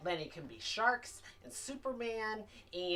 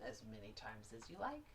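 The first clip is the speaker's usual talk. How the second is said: soft guiding voice; 7 semitones lower, 9 dB quieter, faster than usual. Shown as a demonstration. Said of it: "guiding snake breathing".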